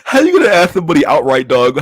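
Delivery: can't believe someone asked that; incredulous outburst. Real, not acted. Reacting to absurd news clip.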